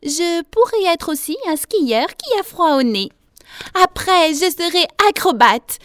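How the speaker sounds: put-on high, loud child's voice; daydreaming little girl. A narrator's reading. Piglet alone at home imagines being grown up, high child voice. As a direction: boast dreamily as a little girl.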